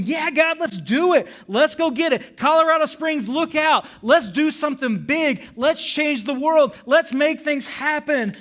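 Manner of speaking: loud, high rallying cry to God; pumped up. Retold or imitated. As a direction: cheer it like a battle charge. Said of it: "so pumped up from that message".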